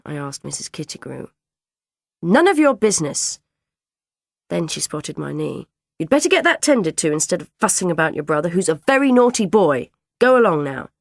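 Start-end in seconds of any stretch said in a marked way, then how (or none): 2.2-10.9 stern dinner lady. Acted. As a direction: tell the girl off like a strict dinner lady. Dinner lady scolding Claire.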